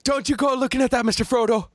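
high, quick hobbit impression; Sean Astin. Podcast host as Sam warning Mister Frodo, high-voiced impression. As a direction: pitch it up and rush out the movie plea. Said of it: "that was Sean Astin".

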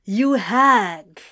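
loud, exclaimed insult; forceful mock-insult outburst; loud declaration. A narrator's reading. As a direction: declare it loudly at a friend. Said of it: "Anthony declared".

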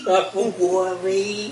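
slow mock Boston accent. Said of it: "the worst Boston accent".

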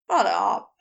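low, growled retort. A narrator's reading. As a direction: growl it back at the teasing. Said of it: "Crowley growled".

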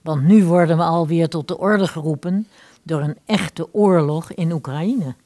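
normal talking voice

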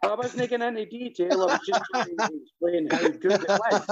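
high-pitched indignant explaining; real squeaky-high defensiveness; defensive protest. Real, not acted. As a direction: defend yourself, voice riding up high. Defensive protest after being teased.

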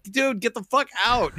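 dragged-out annoyed yell at a slow truck; mock road rage. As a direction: tell the driver off with mock disgust. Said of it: "I'm like".

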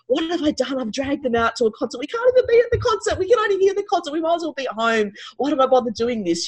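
high, strained self-reproach; despairing inner thoughts. Retold or imitated. Mother blaming herself in her head at camp, high and strained. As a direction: replay the panic of a guilty mother. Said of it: "in my head, I was thinking".

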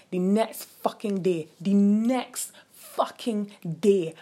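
slow it right down and hammer it home; slow emphatic repeat. Real, not acted. Storyteller to listeners, dragging out the next day for emphasis.

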